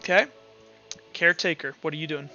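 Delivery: ordinary talk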